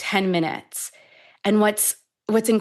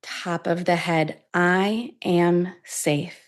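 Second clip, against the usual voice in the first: guided meditation. Acted. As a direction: guide the listener gently into safety, unhurried. Leads a tapping meditation.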